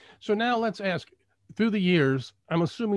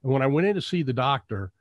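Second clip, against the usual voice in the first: usual speaking voice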